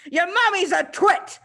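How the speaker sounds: loud, high, contemptuous bellow. Acted. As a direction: bellow the insult down at the child. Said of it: "the Trunchbull bellowed".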